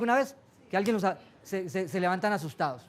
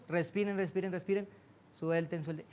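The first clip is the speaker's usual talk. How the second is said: softly, letting the voice drop away; soft breathing cue; 3 semitones lower, 8 dB quieter than usual. Acted. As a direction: coax them to breathe and let go. Meditation cue fading soft. (susurro suave que se apaga)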